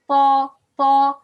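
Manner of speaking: "ta" as a heavy, backed emphatic letter, loud; heavy ta drill. Shown as a demonstration. Teacher drills step by step, loud heavy ta. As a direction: punch out each "ta" thick and heavy. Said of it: "to heavy letter".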